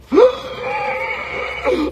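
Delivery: a huge high gasp, like breath rushing back; sharp revival gasp. Acted. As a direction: gasp as if coming back to life. Imita o milagre. (suspiro alto e agudo de quem volta à vida)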